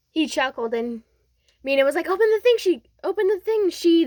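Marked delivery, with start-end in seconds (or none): 2.1-4.0 high, loud, excited prodding; eager urging. Retold or imitated. Mina urging Deku to open the gift, excited. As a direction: push them to do it, pitched up and loud.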